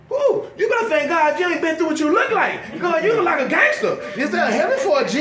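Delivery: high, teasing exclamation; mock disbelief. Comic firing back at a thankful man, mock disbelief. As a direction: rib them with mock astonishment.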